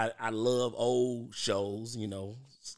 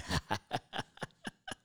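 laughing; 3 semitones lower, 6 dB quieter than usual. Real, not acted. After singing.

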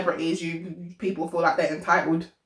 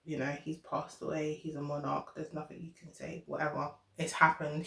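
quiet; 4 semitones lower, 15 dB quieter than usual. Real, not acted. Dismissive muttering, very soft.

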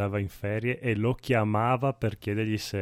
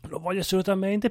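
high, fast, eager; gushing, higher and quick; excited; 10 semitones higher, faster than usual. Retold or imitated. Voicing his own excited reaction.

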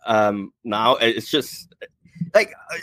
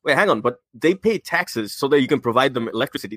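ordinary talk, nothing marked

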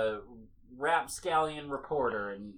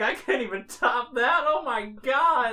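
excited; 8 semitones higher, 7 dB louder than usual. Real, not acted. Amazed at name.

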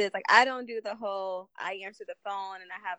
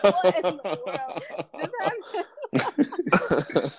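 slow, laughing disbelief; stunned, laughing exclamation; 6 semitones higher, 6 dB louder, much slower than usual. Real, not acted. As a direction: drag it out through real laughter. Friends reacting in disbelief, laughing.

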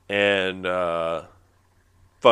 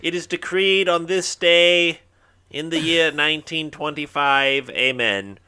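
slow, higher town crier proclaiming; grand rich voice; 6 semitones higher, 4 dB louder, slower than usual. Pretend. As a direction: announce it like a royal herald.

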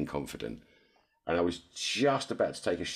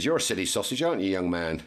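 mock scolding; 6 semitones higher, 4 dB louder than usual. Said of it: "I said".

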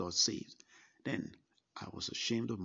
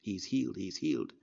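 louder retold yelling; a mild mock yell of joy; 5 dB louder than usual. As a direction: call it out like a doctor who now believes. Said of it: "He was right behind the sick bed yelling".

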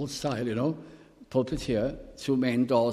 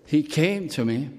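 joyful testimony; 5 semitones higher, 4 dB louder than usual. Retold or imitated. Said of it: "he said".

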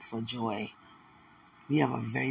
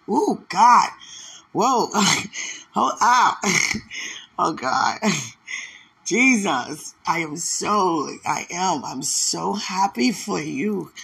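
higher, much louder cries broken by laughs; ecstatic praise; 5 semitones higher, 6 dB louder, slower than usual. Real, not acted. Preacher overcome while blessing a listener, ecstatic praise. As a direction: overcome with joy, exclaim it loudly.

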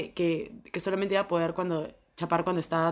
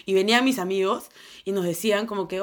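normal talking voice

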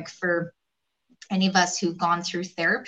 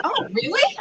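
high-pitched imitation of a surprised client; incredulous, high little question; mock surprise; 13 semitones higher than usual. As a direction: act taken aback, pitched way up. Client reacts to blunt feedback.

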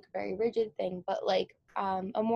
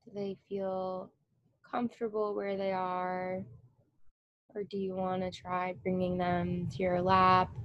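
guided meditation. Acted. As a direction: ease them into stillness with long pauses. Guided meditation begins, eyes closed.

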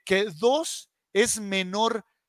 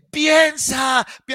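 louder and higher, a sharp mock yell cut off; retold shout; 9 semitones higher, 7 dB louder, slower than usual. Owner shouting at a heckling fan, imitated in a raised mock yell. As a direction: bark it at the fan, then break off. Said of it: "se pone a gritarle al aficionado".

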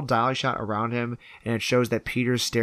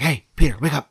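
abrupt, louder mock rousing; imitated wake-up call; 2 semitones higher, 5 dB louder, faster than usual. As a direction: act out rousing someone suddenly. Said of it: "jumps on him".

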